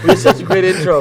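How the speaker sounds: laughing loud complaint. Real, not acted.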